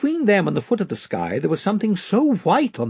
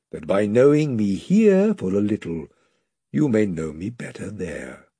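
Aslan, solemn lion; 6 semitones lower than usual. A narrator's reading. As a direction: speak with calm majesty. Aslan voiced low.

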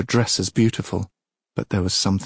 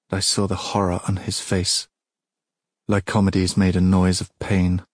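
ordinary delivery

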